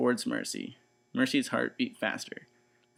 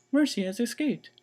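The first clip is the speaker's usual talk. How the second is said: 1 fretful wife; 12 semitones higher, 4 dB louder than usual. Acted. Missus Watson voice.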